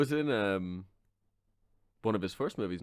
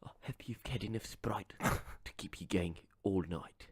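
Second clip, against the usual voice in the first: whispered, softer mock star; hushed Depp whisper; 9 semitones lower, 11 dB quieter than usual. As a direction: breathe it in a creepy whisper.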